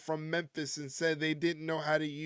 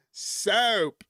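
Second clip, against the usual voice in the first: mock Southern accent; 3 semitones higher, 3 dB louder than usual. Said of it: "that's how people down south in Tennessee talk".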